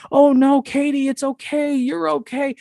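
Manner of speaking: high, cooing mock comfort; simping guys. Guys fussing over a panicking girl, mock simping. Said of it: "all the guys are simping for her".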